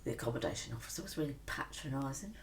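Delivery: normal talking voice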